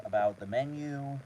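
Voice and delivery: ordinary talk, nothing marked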